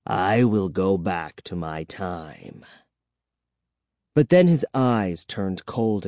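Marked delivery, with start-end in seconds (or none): 0.1-2.7 slow, low vampire vow; hissing vampire. A narrator's reading. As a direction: draw it out deep in the voice. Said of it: "he hissed".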